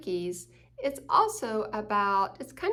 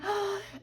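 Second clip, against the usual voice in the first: excited gasp; 10 semitones higher than usual. Acted. Excited at cookie smell.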